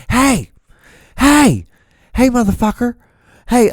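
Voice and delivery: high, loud mock street shout; imitated screaming. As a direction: holler like the man yelling at a car. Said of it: "he was screaming this".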